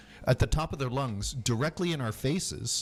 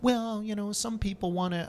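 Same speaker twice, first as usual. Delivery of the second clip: higher mock voice of a cohost; mocking imitation; 7 semitones higher than usual. Teasing his cohost by imitating his voice, mock higher. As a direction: tease by parroting a friend's voice. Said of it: "I'm not making fun of your voice".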